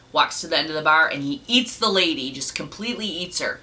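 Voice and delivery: ordinary talk, nothing marked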